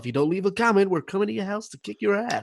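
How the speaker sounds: hyped high-pitched YouTuber parody; Logan Paul. Acted. YouTuber plugging his channel to viewers, mock-hyped. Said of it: "that Logan Paul guy".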